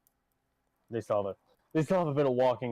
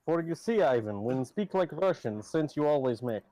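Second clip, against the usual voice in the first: put-on hammy Russian accent, broken English; 5 semitones higher than usual. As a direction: ham up a Russian accent, higher. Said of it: "Am now speak like a Russian".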